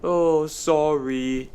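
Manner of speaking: slow, drawn-out mock wail. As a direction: wail the apology, stretching each word. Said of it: "Dennis wailed".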